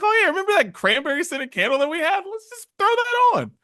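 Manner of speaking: eager, high-pitched acted quote; excited recall. Speaker imitating someone remembering an old candle, excited.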